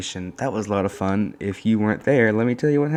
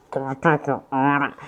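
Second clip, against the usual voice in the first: high, loud drive-through intercom voice; drive-through speaker voice; 6 semitones higher, 5 dB louder than usual. Retold or imitated. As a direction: squawk it like a fast-food speaker. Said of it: "like a drive-through".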